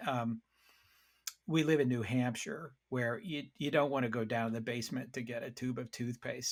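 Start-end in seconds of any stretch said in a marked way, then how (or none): none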